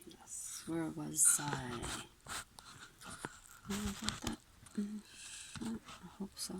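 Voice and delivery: hushed, trailing off; quiet aside. Real, not acted. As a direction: murmur it to yourself. Quiet aside before resuming.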